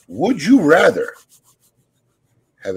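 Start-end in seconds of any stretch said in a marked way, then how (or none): 0.1-1.1 louder, higher, slow announcer read; announcer style. Acted. Host introducing the question, louder announcer read. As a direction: announce the segment title.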